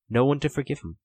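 plain reading voice

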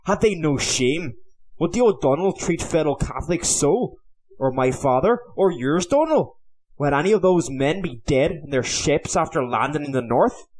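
angry demands. A narrator's reading. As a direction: fling the questions in outrage. Owen furious at his companions.

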